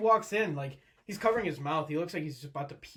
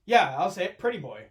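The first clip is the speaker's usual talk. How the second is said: defensive; 4 semitones higher, 9 dB louder than usual. Real, not acted. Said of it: "You got so defensive".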